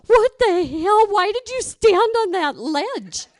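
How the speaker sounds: loud, slow, high alarmed shout; mock yell. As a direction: yell at her for standing on the ledge. Friend yells at teller on ledge.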